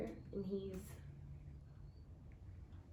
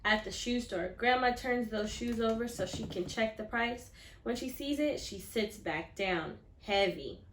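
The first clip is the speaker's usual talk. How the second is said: louder, higher storybook reading; expressive story reading; 4 semitones higher, 9 dB louder than usual. A narrator's reading. Teacher reading the kickball scene to her class, lively storytelling. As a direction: read it big for the class.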